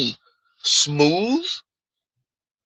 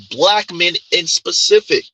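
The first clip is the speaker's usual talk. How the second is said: usual speaking voice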